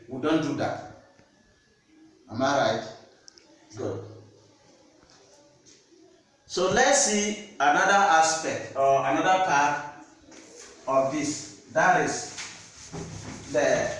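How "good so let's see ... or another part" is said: normal talking voice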